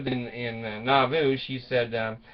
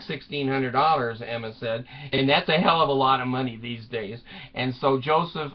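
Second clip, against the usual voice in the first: ordinary delivery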